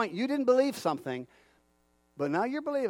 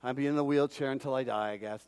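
low, soft, hopeless muttering; mock defeated mumble; 8 semitones lower, 5 dB quieter than usual. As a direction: act out a beaten-down voice, lower and quieter. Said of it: "walking around going".

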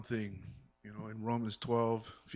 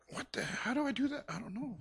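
flustered new mother; 12 semitones higher than usual. Retold or imitated. New mother Mary's uncertainty.

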